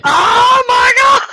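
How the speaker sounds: slow, high, loud shout; starstruck shout. Retold or imitated. Spotting [name] right behind her, loud shout. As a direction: scream it out seeing a rock star.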